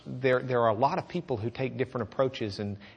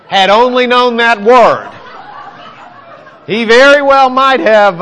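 speaking through loud laughter; cracking up at his own pun; laughing; 8 semitones higher, 16 dB louder, slower than usual. Real, not acted. Preacher laughing over the spay joke. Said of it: "laughing at own joke".

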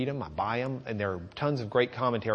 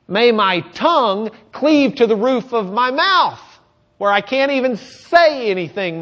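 loud, high, pleading lament; impassioned outcry; 9 semitones higher, 9 dB louder than usual. Acted. Preacher crying out the psalm's curse in anguish. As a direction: cry it out to the Lord in anguish.

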